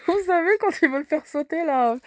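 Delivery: amused, laughing voice; laughing while talking. Real, not acted. Laughs recalling a TV episode.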